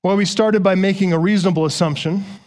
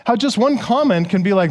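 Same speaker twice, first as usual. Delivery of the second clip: usual speaking voice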